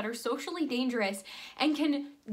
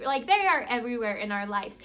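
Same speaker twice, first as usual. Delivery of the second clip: usual speaking voice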